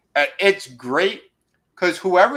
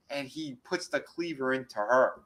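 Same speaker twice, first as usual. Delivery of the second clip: usual speaking voice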